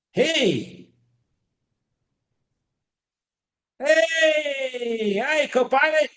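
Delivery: high, bright-pitched hello called out to get attention; calling out hello. Real, not acted. Greeting the AI assistant aloud.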